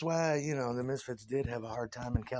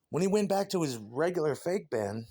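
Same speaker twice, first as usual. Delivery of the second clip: normal talking voice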